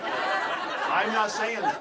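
loud, drawn-out protest through laughter; laughing disclaimer. Real, not acted. Speaker disowns a quoted line to the audience, laughing loudly.

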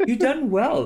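high, hammed-up proud voice; mock proud voice. Game master praising players in a silly voice. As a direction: play it like a proud old mentor.

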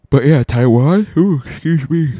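words stretched through a big real yawn. Real, not acted. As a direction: talk right through a yawn, then sigh it out. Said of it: "Sorry about that yawn".

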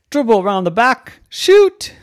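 loud, high-pitched shout; excited call. A narrator's reading. Narrator acts out the girl's basketball practice, excited shout. Calling out shots in story.

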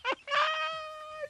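shrill giggle, far above normal; high-pitched helpless cackle; high squealing laugh. Real, not acted. Laughing at the question.

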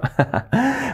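short high giggle; high laugh. Real, not acted. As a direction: laugh at the sweet line. Amused at the lyric.

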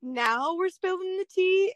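high, loud, playfully outraged, slowing at the end; newlywed protesting the sudden horror stories, mock outrage; mock indignation. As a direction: act betrayed by the married club. New wife's indignant mock reply.

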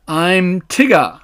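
slow, drawn-out bouncy character voice; Tigger voice. Acted. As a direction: stretch out the name proudly. Tigger introduces himself.

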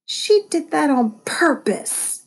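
high, drawn-out indignant hoot. A narrator's reading. Otto accusing his sister over the broken shell, hooting. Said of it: "I hooted".

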